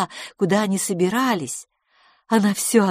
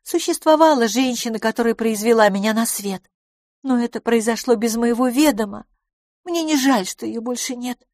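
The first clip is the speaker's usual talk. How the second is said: higher character voice; candid lady friend; 5 semitones higher than usual. Acted. Lady tells guest about her mother, candid. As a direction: hold a higher pitch for the lady.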